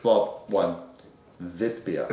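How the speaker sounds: Witbier with a V sound, vit-beer; German pronunciation. Shown as a demonstration. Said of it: "Dave has to prompt me on my German".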